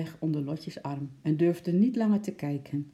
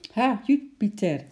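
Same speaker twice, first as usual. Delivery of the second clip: cheerful greeting; 6 semitones higher, 4 dB louder than usual. A narrator's reading. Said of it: "zei Lotje".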